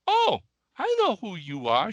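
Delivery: put-on charming Kentucky accent. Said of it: "in that charming little accent".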